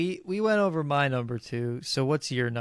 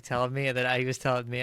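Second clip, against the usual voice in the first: ordinary talk, nothing marked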